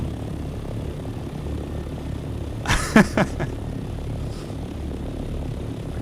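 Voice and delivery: long, airy wheeze of laughter; breathy disbelieving laugh. Real, not acted. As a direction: laugh helplessly under your breath.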